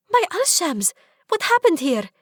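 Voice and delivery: high, loud astonished gasp; shocked at the mess. Acted. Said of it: "looks around in astonishment".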